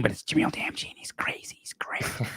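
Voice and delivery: hushed whisper of a forbidden name; whispered secret. Retold or imitated. Agent secretly telling Brent his name, whispering. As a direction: whisper it like gossip you shouldn't share. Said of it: "talk and whisper".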